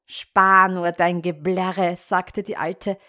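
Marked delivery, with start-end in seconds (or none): 0.1-2.0 loud, slow witch scolding; witch snapping. Acted. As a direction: bark it at her, slowly. Witch snaps at weeping girl.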